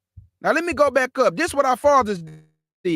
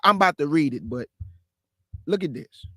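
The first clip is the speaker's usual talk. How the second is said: usual speaking voice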